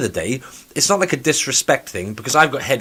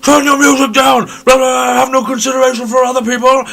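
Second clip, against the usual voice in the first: much higher, loud shout; old man on the train shouts at her over music; mock angry old man; 12 semitones higher, 8 dB louder than usual. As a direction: bellow like a cranky old man.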